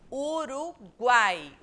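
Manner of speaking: Uruguai drawn out very slowly and loud; slow demo. Shown as a demonstration. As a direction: stretch Uruguai so the guai glides. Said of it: "O i vai de carona".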